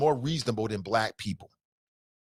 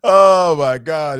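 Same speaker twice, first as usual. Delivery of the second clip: laughing exclamation; 2 semitones higher, 11 dB louder, much slower than usual. Real, not acted. Laughing at own joke.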